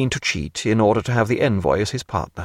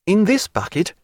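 lightly voiced naturalist, pitched up; eager learned Stephen; 8 semitones higher than usual. A narrator's reading. Stephen walks into the cabin with his bucket, mildly eager. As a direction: announce a find with mild eagerness.

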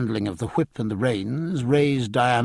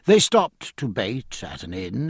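ordinary delivery

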